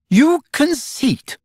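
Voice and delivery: very high, slow, scornful exclamation; drawn-out shocked sarcasm; a girl sneering in mock outrage; frightful sarcasm. A narrator's reading. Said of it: "she exclaimed with frightful sarcasm".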